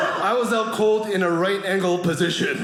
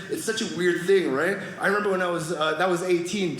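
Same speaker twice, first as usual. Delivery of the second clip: ordinary delivery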